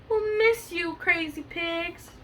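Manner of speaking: high, drawn-out storybook wail; lightly acted farewell cry to the pigs; mock crying out. A narrator's reading. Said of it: "All of the animals cried".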